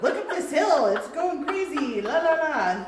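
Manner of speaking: high, loud playful newscaster; over-the-top on-location reporter; news anchor voice. Retold or imitated. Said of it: "we sounded like a news anchor there".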